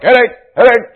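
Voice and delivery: high, loud repeated call; playful yelling. Retold or imitated. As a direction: yell it out as a running joke. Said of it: "we're always yelling this out".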